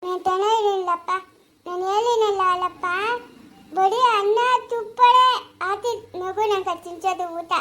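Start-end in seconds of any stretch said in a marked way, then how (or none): none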